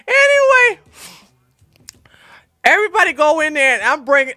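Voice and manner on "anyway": high, loud squeal, barely composed; squeaky from laughter; laughing recovery. Real, not acted. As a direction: try to get back on track after cracking up. Still recovering from laughing fit.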